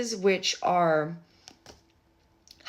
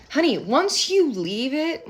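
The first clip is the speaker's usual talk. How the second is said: 1 sassy honey; 7 semitones higher, 7 dB louder, slower than usual. Acted. Sassy aside to querent.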